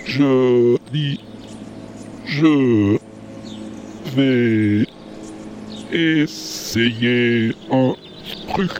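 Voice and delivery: drawn-out slow speech. Acted. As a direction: stretch every word, very slow. Switches to slow talk.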